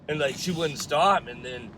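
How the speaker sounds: usual speaking voice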